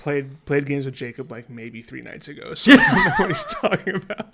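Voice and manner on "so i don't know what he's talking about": high, loud, through laughter; incredulous, cracking up; laughing protest. Real, not acted. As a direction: laugh while denying it all. Laughing off friend's claim.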